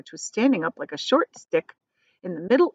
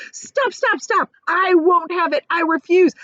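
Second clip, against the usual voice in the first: much higher, louder refusal; frantic cry; 12 semitones higher, 7 dB louder than usual. A narrator's reading. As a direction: protest in a panic. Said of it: "cried the earthworm".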